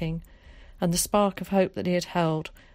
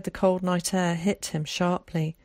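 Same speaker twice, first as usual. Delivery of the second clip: usual speaking voice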